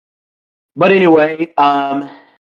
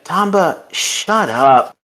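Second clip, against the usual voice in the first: higher-pitched start, dropping on the order; mock scolding; 6 semitones higher, slower than usual. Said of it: "hits him on the head and is like".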